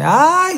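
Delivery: imitating a mother's high-pitched "ay"; drawn-out high wail of dismay, mimicked; mother's shriek. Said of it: "le hace así".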